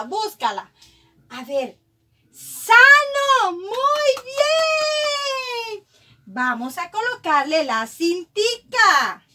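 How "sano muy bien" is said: excited praise. Real, not acted. Teacher praising child's reading. (elogio agudo y muy entusiasta)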